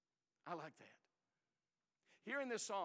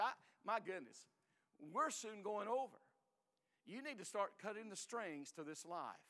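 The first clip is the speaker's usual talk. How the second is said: ordinary talk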